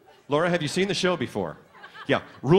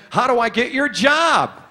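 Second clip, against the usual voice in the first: higher, punchy audience question; brash calling out; 6 semitones higher, 4 dB louder than usual. Retold or imitated. Young audience member to the announcer on stage, brash call. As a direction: toss out a cheeky question, pitched up.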